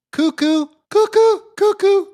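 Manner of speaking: high, loud cuckoo-bird call. Pretend. Host mocking a liberal protester as a cuckoo bird, shrieked high. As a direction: squeal it like a cuckoo clock.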